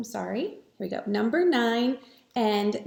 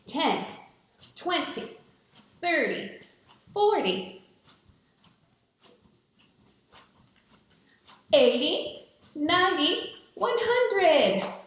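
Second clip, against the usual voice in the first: counting during jumping jacks; 7 semitones higher, slower than usual. Real, not acted. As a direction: shout each number as you jump. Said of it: "we're going to say the number".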